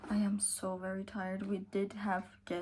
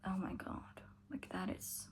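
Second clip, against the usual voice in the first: hushed whisper, much softer; awed whisper; 12 dB quieter than usual. Real, not acted. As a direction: breathe it out in quiet awe. Awed about the second book.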